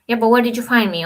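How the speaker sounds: usual speaking voice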